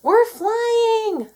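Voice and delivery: very high, loud thrilled cry; ecstatic shout. A narrator's reading. Moo shouting as the tractor flies, thrilled. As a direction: whoop with joy. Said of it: "shouted Moo".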